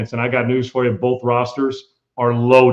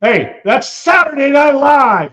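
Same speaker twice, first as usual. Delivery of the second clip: high, loud, slow exclamation; like spotting a comedy sketch on live news; excited exclaim; 13 semitones higher, 7 dB louder, slower than usual. Real, not acted. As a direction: burst out in amused disbelief.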